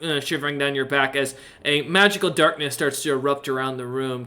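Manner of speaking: ordinary delivery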